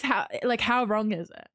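normal talking voice

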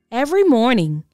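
plain reading voice

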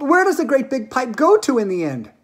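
high, loud alarmed question; shrieked. Acted. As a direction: demand an answer in a shriek. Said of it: "shrieked Missus Salt".

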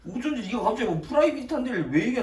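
high, heated protest; indignant arguing. Real, not acted. Friend defending the private study room, indignant. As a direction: protest in a strained high pitch.

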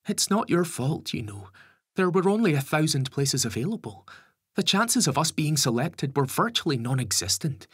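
worried mum voice. A narrator's reading. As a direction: press him for a promise. Lisa consoles Gary.

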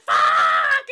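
loud drawn-out mock roar; frustrated shout. Acting out the shooter after his last shot, frustrated shout. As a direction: let the curse rip in a long shout.

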